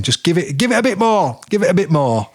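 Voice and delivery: urging. Retold or imitated. Mick urging more voltage.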